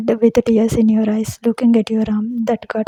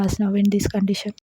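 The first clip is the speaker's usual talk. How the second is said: ordinary delivery, nothing marked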